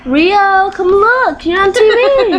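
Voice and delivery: high-pitched call to a pet; high, eager coaxing. Real, not acted. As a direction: call the bird over, voice lifted. Calling the pet bird to camera.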